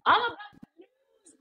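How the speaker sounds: mock kid about to blow; imitating agitated son. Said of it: "He's like".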